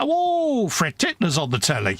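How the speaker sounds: excited mum, the first word leaping very high; a mum calling in a sudden high excited burst; imitating mum. Son imitates his mum announcing the telly show, excited and high. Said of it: "me mum would come in and she'd go".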